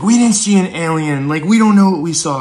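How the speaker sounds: high, loud, insistent denial; a high, loud, insistent younger brother; high freaked-out brother denying it; rattled brother. Retold or imitated.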